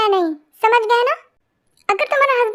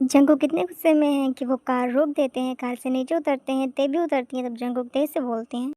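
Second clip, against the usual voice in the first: low, soft storytelling voice; low murmured fanfic narration; story narration; 8 semitones lower, 6 dB quieter than usual. A narrator's reading. Narrator sets the roadside scene.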